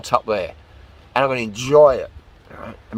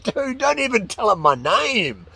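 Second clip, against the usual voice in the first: high, hammed-up alarm; appalled refusal; 8 semitones higher than usual. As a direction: play a horrified brush-off to a friend. Acted reply refusing a hypothetical date.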